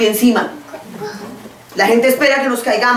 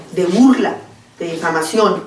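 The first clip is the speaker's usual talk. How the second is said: ordinary talk, nothing marked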